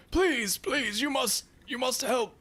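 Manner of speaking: put-on high, thin old man's voice, imploring; high-pitched, urgent plea for help; pleading old man. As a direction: beg desperately as a frightened old man. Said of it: "you hear the old man behind him go".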